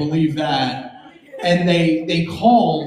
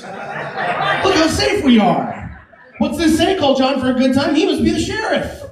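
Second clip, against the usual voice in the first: high, gushing mock wonder; mock awed tourist; 6 semitones higher than usual. Comic touring a public bathroom, high mock wonder. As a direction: marvel at every grimy detail.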